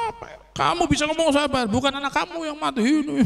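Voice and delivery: high-pitched mock outburst; retold indignant protest, pitched high; imitated anger. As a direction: act out a grieving woman snapping back.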